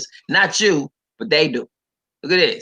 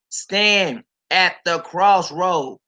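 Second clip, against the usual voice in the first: solemn quotation; 4 semitones higher, much slower than usual. Acted. Quoting God slowly.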